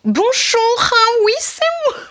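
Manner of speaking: very high squeaky little rabbit voice; cute toy rabbit. Acted. Toy rabbit introducing itself to viewers, high squeaky voice. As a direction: squeak it like a tiny talking bunny.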